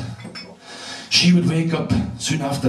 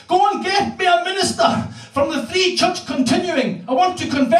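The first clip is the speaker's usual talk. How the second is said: put-on high, shouted old woman's plea; hammed-up urgent granny; 12 semitones higher than usual. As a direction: bark it like a frantic granny. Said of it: "And she said".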